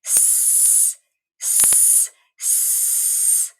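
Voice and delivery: long hissing s through the teeth; drawn-out s like a snake. Shown as a demonstration. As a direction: hiss the S like a snake. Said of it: "blow through your teeth".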